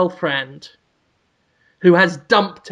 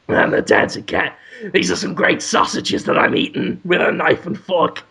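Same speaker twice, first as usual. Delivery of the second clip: raspy put-on cat voice; self-satisfied talking cat; half-whispered comic cat character; Willem Dafoe.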